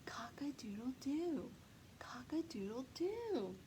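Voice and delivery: a tiny breathless whisper of a crow. Acted. Rooster losing his voice, whispered crow. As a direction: rooster trying to crow but only whispers.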